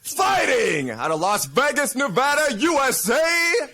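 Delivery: speeding up to reveal. Shown as a demonstration. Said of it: "you say what I tell you".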